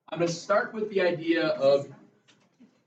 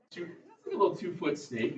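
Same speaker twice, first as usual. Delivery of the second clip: usual speaking voice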